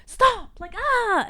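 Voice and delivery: high, squealed mock outburst; retold hot outburst. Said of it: "I came in hot".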